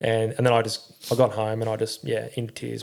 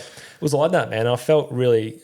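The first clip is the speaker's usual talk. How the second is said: ordinary talk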